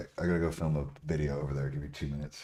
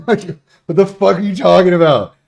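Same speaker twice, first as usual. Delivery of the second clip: loud, high, laughing outburst; laughing disbelief; 14 semitones higher, 19 dB louder than usual. Real, not acted. Streamer laughs at a steroid user's complaint, loud laughing disbelief. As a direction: blurt it out through laughter.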